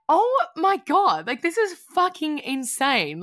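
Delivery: half-laughing disbelief at the numbers; amazed. Retold or imitated. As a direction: gasp it out in disbelief. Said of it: "I was like".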